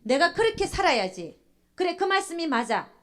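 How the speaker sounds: high, loud burst of eager agreement; joyful agreement. Acted. Churchgoer hearing God's word, joyfully convinced. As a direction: act out instant, delighted acceptance of the message. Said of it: "즉시 기쁨으로 받는다는".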